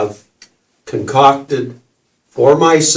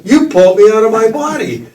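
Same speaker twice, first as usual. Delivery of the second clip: high, fast and louder, laughing; laughing disbelief; 9 semitones higher, 3 dB louder, faster than usual. Retold or imitated. Accusing the kid who pulled him out, laughing disbelief. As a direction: blurt it out in amused shock.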